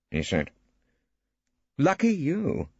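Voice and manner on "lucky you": pointed, dry jab with a lifted voice; with meaning. A narrator's reading. Barrister brushing off an unwanted admirer, pointedly. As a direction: make the barb land. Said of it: "Phillida said with meaning".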